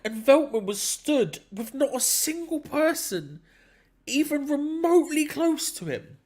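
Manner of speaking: baffled by the marking; laughing exasperation. Real, not acted.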